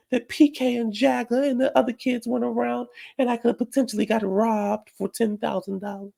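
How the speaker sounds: high, sing-song mimicry held throughout; mock a long excuse in a put-on high voice; mocking Dorit. Dorit explaining why she stayed in, mocked in high voice.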